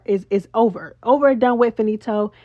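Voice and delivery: usual speaking voice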